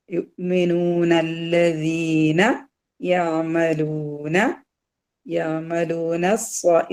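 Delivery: slow chanted യുഅ്മിനൂനല്ലദീന, na running into lla; long oo in യഅമലൂന; linked recitation. Shown as a demonstration. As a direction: recite യുഅ്മിനൂനല്ലദീന as one drawn-out link. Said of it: "നൂനിന് ഫത്തയിൽ നിന്ന് ലാമിലോട്ട് വരും".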